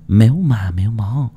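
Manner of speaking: plain reading voice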